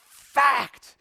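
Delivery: loud, high shout of fact; yelling. Retold or imitated. Older brother shouting at his brother over the word. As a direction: bark it right in his face. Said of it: "as loud as he can".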